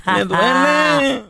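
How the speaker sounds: slow pained whimper; whiny truck voice. Pretend. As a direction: drawl it like a hurt truck. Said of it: "le dice". (voz lastimera de troquita)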